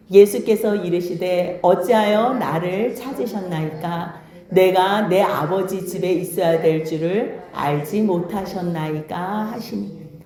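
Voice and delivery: slow, measured, low-pitched reading aloud; scripture reading. A narrator's reading. Preacher leads congregation in reading Luke aloud, slow measured scripture reading. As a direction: read the verse out solemnly and evenly, drawing out the phrases.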